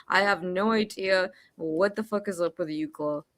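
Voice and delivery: ordinary delivery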